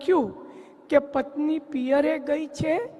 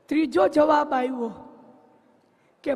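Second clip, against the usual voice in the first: normal talking voice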